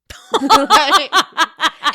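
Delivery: loud, high burst of laughter; explosive laughing fit; real belly laugh. Real, not acted. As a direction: crack up completely. Cracks up over the killed cat.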